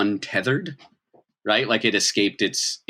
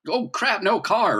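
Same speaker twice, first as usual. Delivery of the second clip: much higher, sudden dazed outburst; startled bystander; 13 semitones higher than usual. Acted. GM voicing freed bystanders snapping out of it, higher surprised. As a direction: squeak it out as a stunned bystander. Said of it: "they're like".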